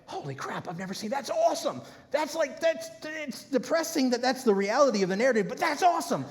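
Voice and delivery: high, excited "that's awesome"; amazed reaction. Retold or imitated. Pastor recalling his TikTok discovery, excited. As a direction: gush with sudden amazement. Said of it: "and I went".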